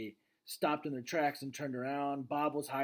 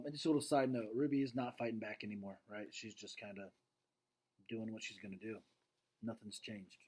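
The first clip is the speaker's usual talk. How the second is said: plain reading voice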